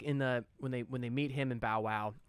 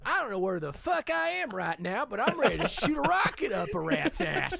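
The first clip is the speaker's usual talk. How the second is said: John Cena voice; 6 semitones higher, 5 dB louder than usual. Retold or imitated. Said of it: "John Cena coming in and been like".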